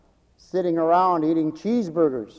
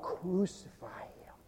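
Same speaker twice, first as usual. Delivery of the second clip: hushed crowd cry; 17 dB quieter, slower than usual. Retold or imitated. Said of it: "The crowd cried out".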